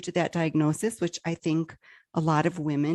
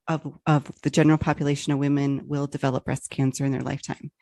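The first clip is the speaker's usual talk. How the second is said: ordinary delivery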